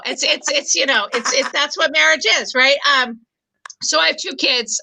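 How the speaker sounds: ordinary talk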